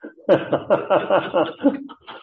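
a high, open laugh at the joke; burst of laughter. Real, not acted. Audience laughs at seminary joke.

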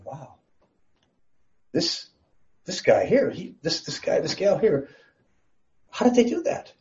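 high, halting voice of an astonished onlooker; amazed angels. Acted. Angels watching a human resist temptation, amazed. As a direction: stumble over the words in hushed wonder, pitch climbing. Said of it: "there could be angels watching and learning something".

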